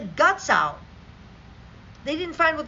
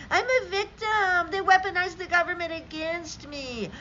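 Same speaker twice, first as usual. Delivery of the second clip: mocking whiny victim; 7 semitones higher than usual. Mocking beta males complaining, a high whiny voice. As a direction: sneer it like a sulking child. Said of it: "Whining, crying".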